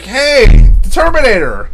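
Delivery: loud, high hype shout; hammed-up big reveal, drawn-out hey; mock excited announcement. Streamer mocking a game's guest character reveal, hammed-up excitement.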